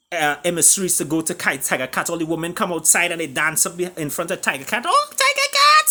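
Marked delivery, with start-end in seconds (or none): 4.9-5.9 shrill swooning fan shriek, much higher and louder; fans screaming. Retold or imitated. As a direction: squeal it like a fainting admirer. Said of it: "screaming and stuff".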